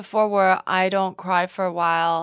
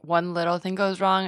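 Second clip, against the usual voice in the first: usual speaking voice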